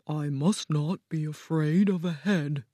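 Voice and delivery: quietly talking himself into courage; Scarecrow to himself. A narrator's reading. Scarecrow steadies himself before the throne room, quietly nervous.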